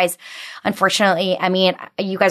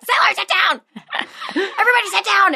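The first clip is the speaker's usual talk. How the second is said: much higher, hammed-up angry order; mock furious yell; 13 semitones higher than usual. Mom yelling at her kids in a mock rage. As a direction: bark it like a mom losing it. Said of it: "I am on one".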